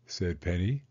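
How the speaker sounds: plain reading voice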